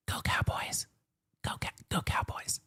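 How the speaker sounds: whispered defiant chant, voiceless; sneaky whisper. Retold or imitated. Kid whispering team chant to disapproving parents, sneaky whisper. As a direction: breathe it like a secret cheer.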